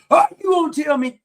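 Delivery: high, loud imitation of an indignant hothead; mock worked-up outburst. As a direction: burst out mockingly, all worked up. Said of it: "they all worked up".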